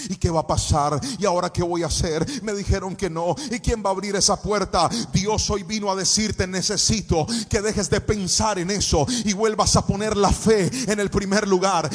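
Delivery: loud preaching over music, building up; impassioned sermon delivery; full-force preaching. Acted. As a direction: preach it to the congregation with rising fervor.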